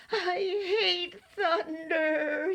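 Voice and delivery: high, slow, quavering creature; frightened whimper. A narrator's reading. Monster in Owl's arms during thunder, frightened whimper. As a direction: whimper it out, stretching the words. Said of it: "he whispered".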